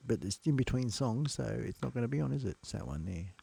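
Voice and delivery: ordinary delivery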